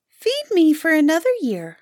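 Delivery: high, piping bird voice; talking grouse. A narrator's reading. Grouse returns and asks again.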